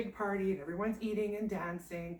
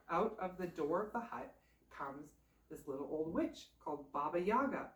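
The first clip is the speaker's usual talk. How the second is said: ordinary delivery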